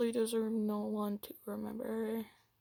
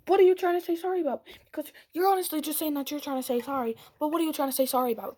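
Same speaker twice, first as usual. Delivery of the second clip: retold angry retort; 5 semitones higher, 8 dB louder, faster than usual. Retold heated texting fight.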